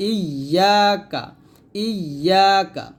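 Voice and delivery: "ইয়া কা" slow, leaning on a held y; drawn-out "ইয়া" with doubled consonant. Shown as a demonstration. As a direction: press and hold the y in "ইয়া কা". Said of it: "তাসদিদের উচ্চারণটা".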